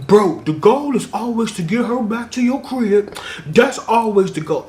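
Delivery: tossing off bro wisdom, half mocking; bro dude quote. Internet dudes giving dating advice, mock bro voice. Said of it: "they used to be like".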